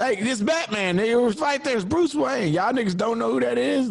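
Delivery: high, worked-up comic disbelief; mock outrage. Comic outrage about Batman's mask.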